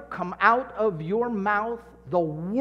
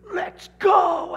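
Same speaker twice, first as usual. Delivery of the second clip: high, loud shout to fire up the team; rallying shout; 12 semitones higher, 7 dB louder than usual. Retold or imitated. Captain firing up the team before the game, loud shout. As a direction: yell it like a captain before kickoff.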